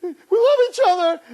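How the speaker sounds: very high wailing with sobs between phrases; hammed-up tearful squeal, slow and loud; mock crying. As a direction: imitate a girlfriend bawling and pleading. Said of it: "she starts crying".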